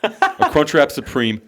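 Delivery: giddy with hunger, laughing through it; retold excitement. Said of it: "I was so excited".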